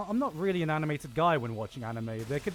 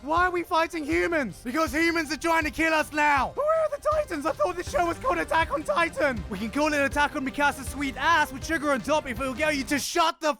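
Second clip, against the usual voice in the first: high, raised exasperated yelling; mock frantic argument; 10 semitones higher, 4 dB louder than usual. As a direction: bicker like outraged characters in a shouted parody. Acts out characters arguing over the fighting.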